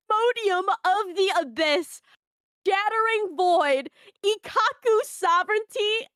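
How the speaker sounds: high, grand roll call of moves; dramatic attack announcement. Acted. Reading the crazy page of attack names, epic announcer delivery. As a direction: proclaim each name, pausing between them.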